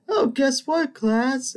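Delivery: mock-sweet, high lilt dangling news before a class; teasing teacher announcement. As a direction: imitate a teacher in a very high sing-song.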